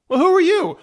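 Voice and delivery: high-pitched puzzled question; bewildered little soul. Acted. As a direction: ask it high and confused. Little Matt questions higher self.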